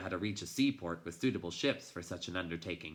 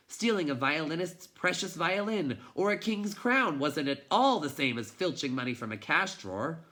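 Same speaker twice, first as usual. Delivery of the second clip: higher and louder, pressing and anxious; agitated, rising guilt; 7 semitones higher, 5 dB louder than usual. A narrator's reading. Narrator voicing Bastian's mounting guilt over the stolen book. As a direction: let the panic climb with each clause.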